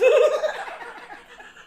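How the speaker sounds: high helpless laughing fit; high squealing laugh; burst of laughter. Real, not acted. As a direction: crack up at the picture.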